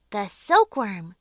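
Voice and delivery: a loud, slow, excited cry; cried out. A narrator's reading. Old green grasshopper bursting out with the answer, loud and excited. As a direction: announce the silkworm like a sudden discovery. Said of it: "cried the old green grasshopper".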